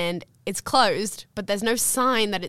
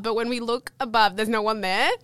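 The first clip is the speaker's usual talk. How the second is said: usual speaking voice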